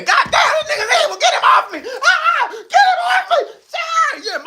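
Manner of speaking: high, loud panicked shrieks with laughter; panicked bully. Acted. Bully screams to be pulled off him in panic. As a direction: play the bully begging to be rescued, screaming high.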